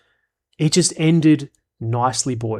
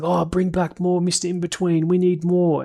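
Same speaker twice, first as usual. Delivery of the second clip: higher-pitched mock pleading; whiny fans; 6 semitones higher than usual. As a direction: lightly mimic begging fans. Imitates pleading fans in comments.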